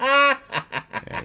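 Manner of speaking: high, loud mock reply to a podcast; loud, high butt-in; acted interjection. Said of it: "It's like".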